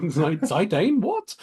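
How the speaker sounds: squeaked, rising disbelief; comic high-pitched bafflement; incredulous reaction. Retold or imitated. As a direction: shoot way up in pitch on the question. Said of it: "I'm like".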